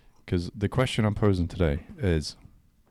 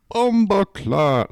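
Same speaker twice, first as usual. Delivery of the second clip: put-on high, slow, loud Jamaican accent; 11 semitones higher, 6 dB louder, much slower than usual. Joking Jamaican accent greeting.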